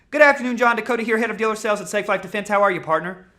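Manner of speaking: fast, loud and high all the way through; wrong way: rattling off the pitch too fast; rushed, too fast. Shown as a demonstration. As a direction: blurt the whole greeting at x1.8 speed. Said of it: "You think you're gonna sell like that".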